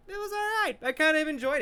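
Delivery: very high, noncommittal squeak; high-pitched concession. Acted. Reviewer giving his consensus, high-pitched concession. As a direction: pitch the verdict way up. Acting out his final verdict.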